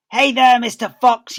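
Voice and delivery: loud high taunting yell; yelling Bunce. Acted. Bunce yelling to the fox from his tractor. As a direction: lean out and holler it. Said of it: "yelled Bunce".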